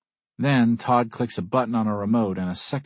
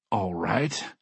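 plain reading voice

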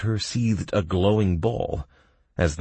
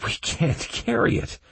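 hasty; 11 semitones higher than usual. A narrator's reading. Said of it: "I said hastily".

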